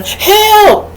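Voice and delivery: high, loud mock cry for help; long high-pitched acted yell; hollering. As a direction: holler like a drowning swimmer. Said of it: "Then you hollering".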